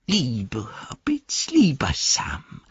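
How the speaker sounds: slow unvoiced hissing whisper; Gollum whisper. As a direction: wheedle it in a sly whisper. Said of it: "sagte er".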